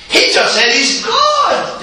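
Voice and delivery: high laughing shout, slow. Acted. Critics gloating.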